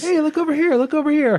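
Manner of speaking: high cartoonish call; mock squeaky label voice. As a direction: play the tiny print begging to be noticed.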